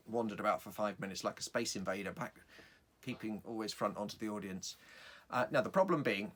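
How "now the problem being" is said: usual speaking voice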